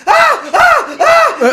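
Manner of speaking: high laughing shriek; panicked scream. Retold or imitated. Coworker fleeing a possum in the shed, panicked laughing shriek.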